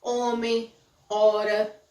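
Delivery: "Homem" and "hora" starting straight on the vowel; measured demo of "Homem, hora" without any H; silent H. Shown as a demonstration. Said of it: "palavras que contêm H não são pronunciadas".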